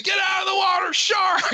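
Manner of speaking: high, loud shouted alarm; high panicked shout from the shore; screamed warning. Retold or imitated. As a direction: yell it down the beach in a panic. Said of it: "running down the beach screaming".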